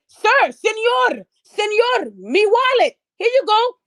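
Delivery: high, slow drawn-out señor, hammed-up politeness; mocking high-pitched woman calling señor; mock Mexican woman. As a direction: lay it on thick as the honest woman returning the wallet. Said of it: "And the woman said".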